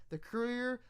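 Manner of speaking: usual speaking voice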